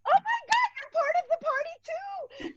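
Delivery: overjoyed mother planning a party; thrilled mom. Retold or imitated. Mom hearing her baby's first Spanish words, thrilled squeal. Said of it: "I literally looked at him and I'm like".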